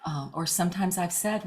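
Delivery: usual speaking voice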